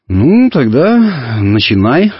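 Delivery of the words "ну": plain reading voice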